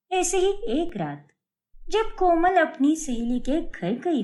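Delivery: high, lively storyteller voice; story narration. A narrator's reading. Narrator telling listeners about Komal's late night, high storytelling voice. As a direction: set up the night scene like a narrator.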